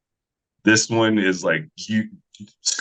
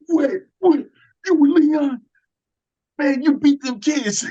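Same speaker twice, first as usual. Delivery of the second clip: much higher, louder squeal of disbelief; stunned high squeal; 3 dB louder than usual. Retold or imitated. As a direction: imitate a fan losing it. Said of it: "And he goes".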